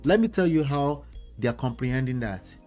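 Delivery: ordinary delivery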